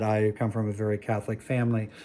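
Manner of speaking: ordinary delivery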